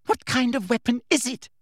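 high-pitched, hard interrogation; harsh. A narrator's reading. Umbridge gripping Hermione's shoulders, harsh. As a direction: demand it severely. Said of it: "said Umbridge harshly".

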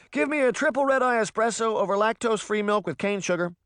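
high-pitched fussy customer voice; high, insistent coffee-shop customer; demanding customer. A narrator's reading. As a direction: rattle off a picky coffee order. Demanding customer reeling off order.

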